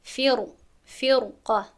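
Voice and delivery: فرقه with a full ر; heavy r. Shown as a demonstration. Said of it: "نمیگویم فر".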